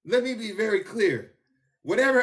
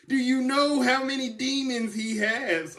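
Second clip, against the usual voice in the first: usual speaking voice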